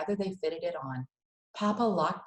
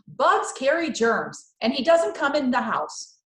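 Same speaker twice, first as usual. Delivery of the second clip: loud, higher, slow on bugs carry germs; firm Mama; 6 semitones higher, 8 dB louder than usual. Acted. Mama forbidding the cricket in the house, loud and firm. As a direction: lay down the law.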